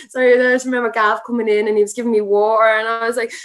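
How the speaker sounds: ordinary delivery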